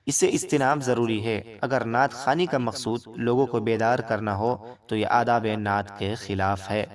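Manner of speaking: normal talking voice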